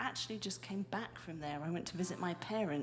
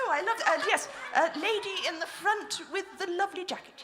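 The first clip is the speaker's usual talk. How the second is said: mock game-show host; 9 semitones higher, 7 dB louder than usual. Mock host calling on audience.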